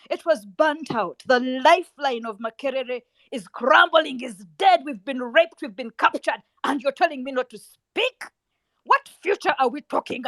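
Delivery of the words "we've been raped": normal talking voice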